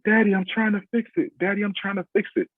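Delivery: high child voice, mock upset; imitated distressed daughter. Crying daughter trying to fix her hair, imitated high plea. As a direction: plead like a little girl, pitched high. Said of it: "she's crying, and she's like".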